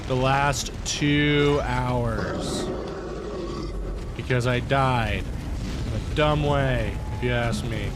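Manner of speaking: loud, slow exasperated rant. Real, not acted. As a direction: grind out the frustration. Frustrated after dying.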